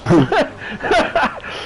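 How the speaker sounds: a real burst of laughter at the memory; amused laugh. Real, not acted. Telling a mate about the mobster party, laughing. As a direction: laugh it off, delighted. Recalling the big drinking night.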